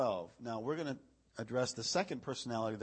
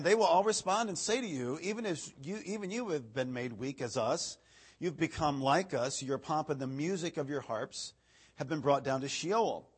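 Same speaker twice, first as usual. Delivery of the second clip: raised, declaiming pulpit reading voice; scripture reading; 5 dB louder than usual. Acted. Preacher reading Isaiah to the congregation, declaiming. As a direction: proclaim the verse to the room.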